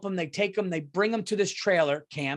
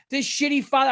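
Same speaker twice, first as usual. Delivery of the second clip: higher, stress moving to "father"; disgusted repeat; 7 semitones higher, 4 dB louder than usual. Real, not acted.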